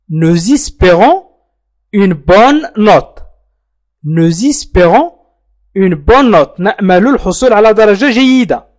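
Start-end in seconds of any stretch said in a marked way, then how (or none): 0.1-6.6 slow model phrase. Shown as a demonstration. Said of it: "teacher models French sentence".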